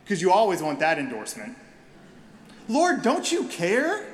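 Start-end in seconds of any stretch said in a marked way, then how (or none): 2.7-4.1 high, slow, pleading accusation; exasperated plea. Acted. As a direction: drag it out like a wounded complaint. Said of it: "Martha says".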